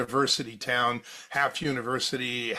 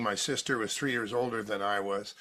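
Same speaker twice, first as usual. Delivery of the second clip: normal talking voice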